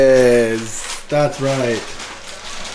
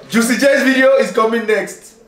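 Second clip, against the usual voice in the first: excited; 7 semitones higher than usual. Real, not acted. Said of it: "Ah, I love saying that".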